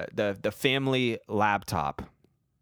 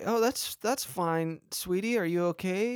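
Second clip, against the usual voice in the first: higher reassuring mom voice; 7 semitones higher than usual. Retold or imitated. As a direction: gently reassure like a caring mom. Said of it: "my mom was like".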